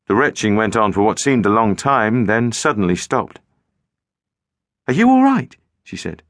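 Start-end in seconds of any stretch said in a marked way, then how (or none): 4.9-5.6 much higher, louder, worried; alarmed. A narrator's reading. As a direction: call out in alarm. Girl alarmed by retching.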